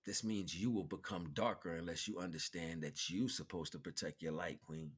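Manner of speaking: usual speaking voice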